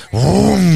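imitated car pulling away; vocal engine rev; engine vroom. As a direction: make the car noise. Car driving off sound.

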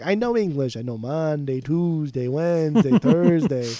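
imitating an Italian wife from a film; hammed-up Italian-accented English, reciting like a learner; mock Italian accent. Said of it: "when his wife was like".

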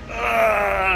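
sudden high cry of agony; a short loud scream of pain, pitched high. Acted. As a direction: cry out as death floods in. Player's character screaming in pain.

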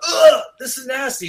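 high, loud groan of revulsion; loud mock-grossed-out yell; disgusted outcry. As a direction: mimic a whole class recoiling in disgust. Classroom reacting to the stink.

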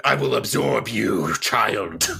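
low mock-villain voice; hammed-up deep menace; Dragon Ball Z villain. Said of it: "It's a Dragon Ball Z episode".

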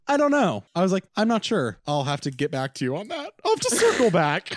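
high, loud squeaky dodge; mock evasive self. Mocking herself dodging his question in a squeaky high voice. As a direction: play your past self ducking the question.

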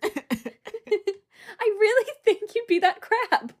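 high, loud, giggly impersonation; mock-earnest quote through laughter. Podcaster imitating a bluntly honest islander, laughing mock insistence. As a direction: insist on it while cracking up.

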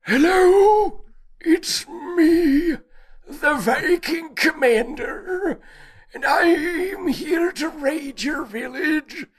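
very high, slow, hammed-up Viking accent; a squeaky comic Viking raider voice, drawn out; absurdly high mock Viking commander. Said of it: "can do a correct Viking accent".

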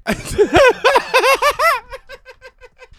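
high loud burst of laughter; real howl of laughter; shrieking laugh. Real, not acted. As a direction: cackle at the absurdity. Big laugh at own story.

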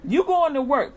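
ordinary talk, nothing marked

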